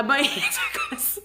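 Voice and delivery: high, loud squeal through laughter; retold panic cracking up into laughter; laughing hysterically. Runner on the treadmill warns the nurse, laughing hysterically. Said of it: "So I'm laughing hysterically".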